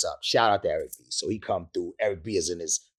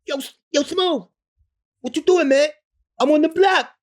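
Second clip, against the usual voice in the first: high, loud imitation of a hyped friend on the phone; high-pitched impression of a homeboy; 8 dB louder than usual. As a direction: pitch way up and call out like an excited buddy.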